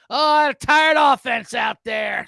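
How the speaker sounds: slow, loud mocking yell; mock shout. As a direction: bellow it like a sarcastic fan. Mocking the coach's excuse.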